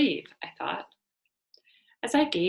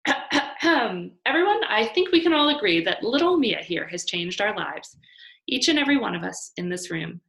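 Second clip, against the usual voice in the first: toast speech; 3 semitones higher, 8 dB louder than usual. Acted. Hank addressing family and friends in a raised toasting voice. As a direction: address the whole room.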